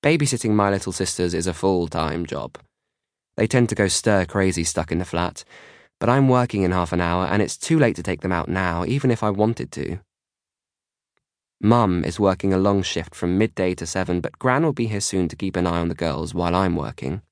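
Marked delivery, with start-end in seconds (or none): none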